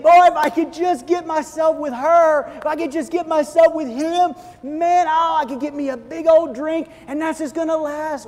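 hammed-up eager wish, high and loud; mock longing. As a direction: dream of a perfect partner. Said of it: "And we think".

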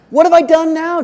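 high, loud protest of a scolded little brother; acted indignant comeback; exasperated protest. A narrator's reading. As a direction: protest loudly, pitch jumping right up.

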